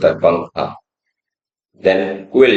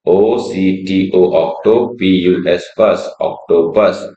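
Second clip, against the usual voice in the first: slow spelling. Shown as a demonstration. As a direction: spell octopus slowly, letter by letter. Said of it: "उसके बाद आता है octopus". (धीरे-धीरे spelling)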